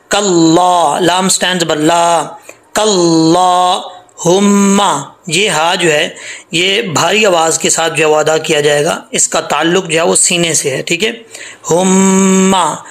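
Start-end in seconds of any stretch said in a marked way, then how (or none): 2.7-5.1 कल्लाहुम्मा with ला stretched and हा heavy, very slow; long standing zabar. Shown as a demonstration. Teacher demonstrates the stretched lam, slowly. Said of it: "लाम खड़ा ज़बर ला". 11.7-12.8 हुम्मा with the मीम held nasally, very slow; nasal ghunna. Shown as a demonstration. Teacher demonstrates ghunna on the meem, slowly. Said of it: "मीम के ऊपर गुन्ना है".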